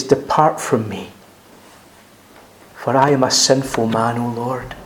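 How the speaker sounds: low, soft broken confession; contrite hush. Acted. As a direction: drop to a low, soft plea of shame. Peter's confession voiced.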